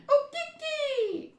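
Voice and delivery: very high, drawn-out squeal; long squeaky monkey cry; monkey screech. Acted.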